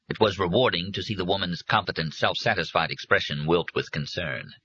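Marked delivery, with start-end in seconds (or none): none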